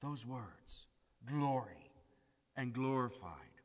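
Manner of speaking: ordinary talk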